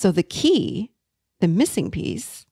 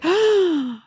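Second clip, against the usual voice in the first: high-pitched dismayed "oh"; stricken gasp; 12 semitones higher than usual. Retold or imitated. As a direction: gasp as if suddenly realizing something terrible. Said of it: "I felt terrible".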